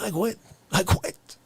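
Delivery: ordinary delivery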